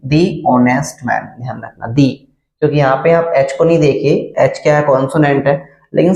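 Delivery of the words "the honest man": the said as "thee", very slow; slow, clear "thee" for the; "thee" before vowel. Shown as a demonstration. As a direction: draw out the as a long "thee". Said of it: "दी का use करेंगे".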